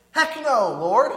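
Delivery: sudden loud, high "Heck no, Lord"; loud refusal. Retold or imitated. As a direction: blurt it out as a shocked refusal. Peter refuses God.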